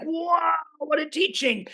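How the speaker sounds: drawn-out, awed wow; awed reaction. Retold or imitated. Teacher marvelling at a teaching, awed. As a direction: stretch it out in wonder.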